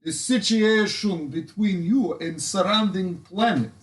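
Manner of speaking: put-on lightly done Russian accent speech; rally the crowd in a Russian accent. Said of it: "I have a Russian accent".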